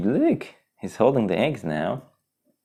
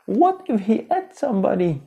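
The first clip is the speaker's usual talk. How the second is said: character voice. Acted. Parent voicing character in story.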